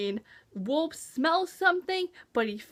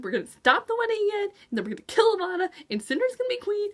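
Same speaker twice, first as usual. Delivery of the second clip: fast, higher mock recap; mock rushed plan; 7 semitones higher, much faster than usual. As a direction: rattle off their plan in a silly rush. Mocking the characters' plan.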